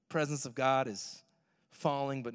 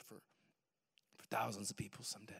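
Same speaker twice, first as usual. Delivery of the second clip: whisper; 5 semitones lower, 11 dB quieter, faster than usual. Retold or imitated. Whispered prophecy quote.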